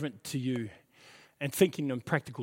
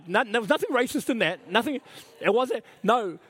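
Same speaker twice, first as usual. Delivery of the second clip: defensive protest; 8 semitones higher, 4 dB louder than usual. Real, not acted. Defends against audience laughter.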